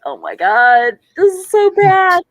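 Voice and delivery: hammed-up horror at her own old film; mock cringe. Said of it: "and I was just like".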